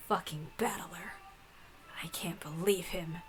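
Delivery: whispered curse. Real, not acted. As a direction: hiss it under your breath in annoyance. Muttering insult at a character.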